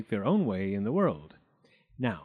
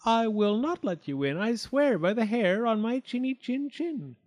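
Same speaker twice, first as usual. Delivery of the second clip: high, loud frightened vow; high-pitched nervous defiance; quivering pig; 10 semitones higher, 4 dB louder than usual. A narrator's reading. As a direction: swear it in a scared piglet voice. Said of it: "quivered the pig".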